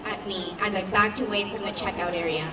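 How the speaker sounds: plain reading voice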